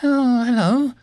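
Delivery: very high, startled fairy squeak; tiny high-pitched flustered greeting. A narrator's reading. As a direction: squeak it out in surprise. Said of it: "she squeaked".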